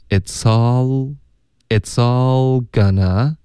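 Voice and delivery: slow "it's all" run together as "itsall"; slow teacher's model of linked "it's all"; linking demo. Shown as a demonstration. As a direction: stretch "it's all gonna", joining s onto "all". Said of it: "it's 跟all要连读".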